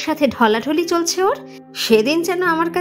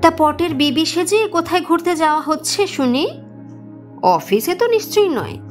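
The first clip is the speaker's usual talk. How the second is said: higher-pitched, sarcastic interrogation; harsh mother-in-law; 6 semitones higher than usual. A narrator's reading. Mother-in-law challenges daughter-in-law on her way out, harsh. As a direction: needle her with pointed, disapproving questions. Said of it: "অজন্তার রুক্ষ কণ্ঠস্বর".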